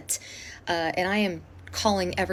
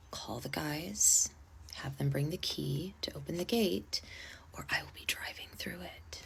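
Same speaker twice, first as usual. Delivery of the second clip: very soft, slow whispered ultimatum; whispered threat; 4 semitones lower, 11 dB quieter than usual. Retold or imitated. Out of patience, threatening the bride in a whisper. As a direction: say it quietly, deadly calm. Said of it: "so I just told her".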